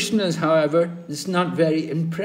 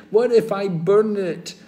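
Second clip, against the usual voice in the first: mock worried; 6 semitones higher, 7 dB louder than usual. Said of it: "I said".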